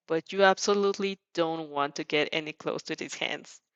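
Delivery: plain reading voice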